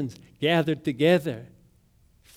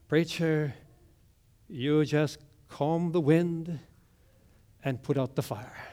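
soft, measured deadpan reply; very calm; 6 dB quieter than usual. Acted. Woman cooling off the preacher, very calmly. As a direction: bring it right down, unhurried. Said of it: "very calmly said".

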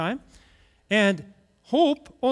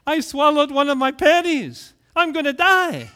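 high, loud mock panic; panicked little boy; 6 semitones higher, 5 dB louder than usual. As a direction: wail it like a child sure he's doomed. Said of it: "He's in a panic".

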